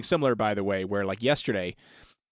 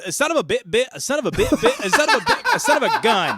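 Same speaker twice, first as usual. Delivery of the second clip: high, loud stammering cartoon pig, laughing; cartoon pig stammering through a curse; Porky Pig stutter; 13 semitones higher, 7 dB louder than usual. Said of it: "and he goes".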